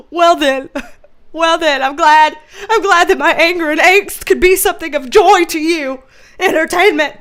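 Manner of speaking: loud, huffy mock gratitude; mock exasperation. Host answering those who find their anger hilarious, playfully exasperated. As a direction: declare it with hammed-up indignation. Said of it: "just stay exasperated".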